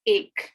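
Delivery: "aik" said slowly like English ache; "aik" like ache, slow and clear; Urdu number one. Shown as a demonstration. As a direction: draw out "aik", rhyming with ache. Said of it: "the first number is one".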